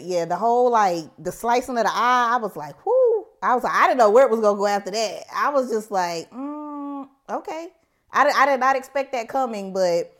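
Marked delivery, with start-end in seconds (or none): 2.8-3.3 high, loud "whoo" of shock; shocked whoop. Retold or imitated. Viewer reacting to the eye slicing, high shocked whoo. 6.3-7.7 soft, high hum and okay; reserved reaction. Retold or imitated. Viewer taken aback by the fight, soft high hesitant okay. Retelling stunned reaction afterwards.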